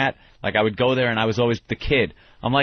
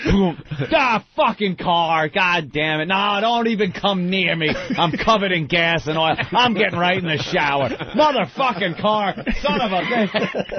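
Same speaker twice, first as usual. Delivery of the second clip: cursing the car in a mock rage, raised pitch; mock angry ranting; 7 semitones higher than usual. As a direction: act out storming in furious at the car. Acting out angry return home.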